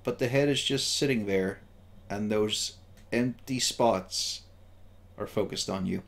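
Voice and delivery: slow, hushed scene setting with long pauses; game master narration. A narrator's reading. Game master telling the player about the empty face, hushed narration.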